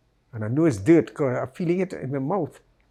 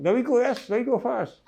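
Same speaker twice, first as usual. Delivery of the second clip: imitating attacker; 9 semitones higher than usual. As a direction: hurry them along like the attacker, high. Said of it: "they say".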